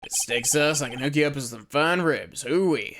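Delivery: put-on lightly done cowboy Western accent; easygoing Western lawman accent; relaxed Western accent, unhurried; Jake Marshall Western accent. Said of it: "I can do a Western accent decently well".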